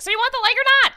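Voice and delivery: high, loud squeaky little lizard-dog merchant; kobold voice. Acted. Kobold stallkeeper calling out to customers, high and squeaky. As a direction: yap it out like a tiny eager kobold shopkeeper. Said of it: "is just like".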